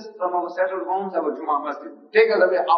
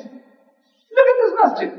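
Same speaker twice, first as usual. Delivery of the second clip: mock alarm; 6 dB louder, slower than usual.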